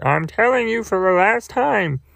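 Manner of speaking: mock-stern puppet warning; Grover voice. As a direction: warn them like a fed-up puppet. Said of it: "and he's like".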